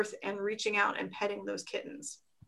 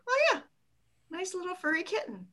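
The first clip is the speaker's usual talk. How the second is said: high cooing; 7 semitones higher, 5 dB quieter, faster than usual. Retold or imitated. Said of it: "suddenly everyone's like".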